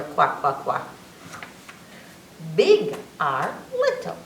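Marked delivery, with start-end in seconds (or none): 0.0-0.9 lower quack. Shown as a demonstration. Teacher quacks again for kids, lower. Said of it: "Q says is quack".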